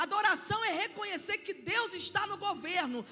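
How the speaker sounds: rising, loud preaching with shouted peaks; preaching at full force. Acted. Pastor preaching to the congregation, loud and fervent. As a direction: preach it out to the church, building.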